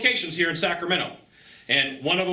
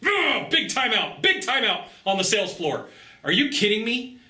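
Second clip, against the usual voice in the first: incredulous outburst; 6 semitones higher than usual. Retold or imitated. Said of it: "I'm like".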